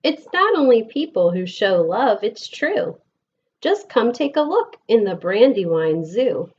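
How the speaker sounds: lilting, sing-song picture book reading; gentle storytime voice with bouncing rhythm; reading to children. Acted. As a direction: read the rhymes aloud to a class of kids. Reads picture book to class.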